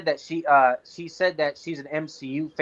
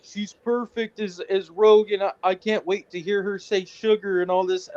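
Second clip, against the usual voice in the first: mock gushing fan; 5 semitones higher than usual. Mocking gushing fan post.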